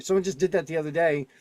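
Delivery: ordinary talk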